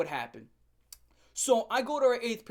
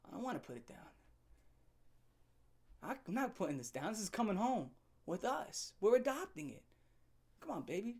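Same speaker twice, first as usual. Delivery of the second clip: soft teasing; 3 semitones higher, 6 dB quieter than usual. Retold or imitated. Teasing her with the bird.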